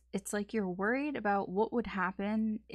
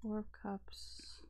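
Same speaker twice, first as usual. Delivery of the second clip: whispered; 9 dB quieter, much slower than usual. Real, not acted. Reading tarot cards to herself.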